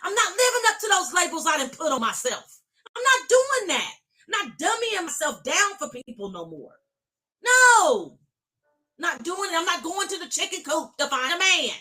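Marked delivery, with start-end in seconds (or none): 2.9-10.9 defiant shout. Real, not acted. Defiant declaration in sermon.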